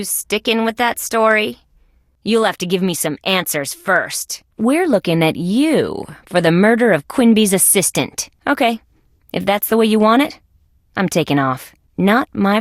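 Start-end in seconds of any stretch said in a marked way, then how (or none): none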